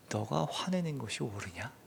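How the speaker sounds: restrained, quiet divine questioning; God. Acted. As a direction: ask softly without anger. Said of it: "화를 내시지 않고 묻습니다".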